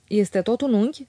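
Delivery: ordinary delivery, nothing marked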